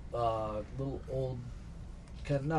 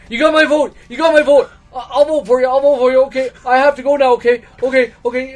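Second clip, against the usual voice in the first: much higher, much louder panicked squeal, fast at the end; a terrified man promising his vote to get away; scared villager; 20 dB louder, faster than usual. Acted. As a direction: blurt it out in a frightened rush. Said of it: "He's like".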